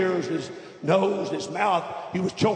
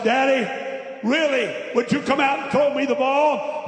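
put-on high, louder plea of a little boy; pleading child; 9 semitones higher, 4 dB louder than usual. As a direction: beg Daddy insistently, voice pitched up. Boy pleads with father to play.

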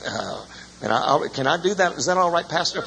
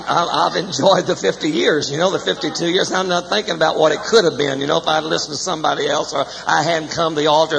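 preaching testimony; 4 semitones higher, 4 dB louder than usual. Acted.

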